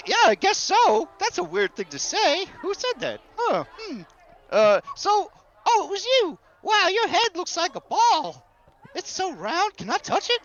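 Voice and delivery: put-on high, childlike, marveling fellow; curious, excitable Gus. Marveling at a stranger's round head, childlike and curious. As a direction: wonder aloud, pitch bouncing high. Game master plays Gus.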